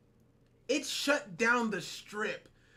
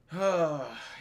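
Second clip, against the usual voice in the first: long sigh after a rant; low, winded sigh; weary sigh; 4 semitones lower than usual. Real, not acted. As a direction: let out a heavy breath.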